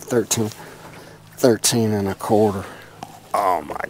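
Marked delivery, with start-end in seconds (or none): none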